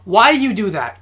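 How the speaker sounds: ordinary delivery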